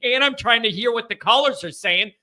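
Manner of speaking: usual speaking voice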